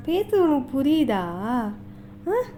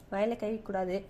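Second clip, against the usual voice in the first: much lower and softer, matter-of-fact; low gentle admonition; 8 semitones lower, 12 dB quieter, much faster than usual. Real, not acted. Correcting baby's hand in mouth. (தாழ்ந்த குரலில் மெதுவாகக் கண்டித்தல்)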